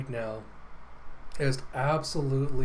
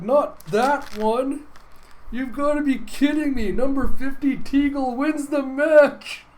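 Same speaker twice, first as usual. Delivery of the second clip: high, loud, drawn-out announcer call; announcer screaming in disbelief; stunned announcer shout; 11 semitones higher, 7 dB louder than usual. Acted. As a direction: yell the upset winner like a shocked commentator. Announcer shouts surprise winner.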